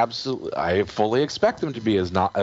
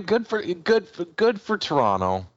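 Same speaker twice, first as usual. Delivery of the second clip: mock cheerful praise, higher pitch; sarcastic; 10 semitones higher than usual. Real, not acted. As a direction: congratulate them with fake cheer. Mock praise of rival team.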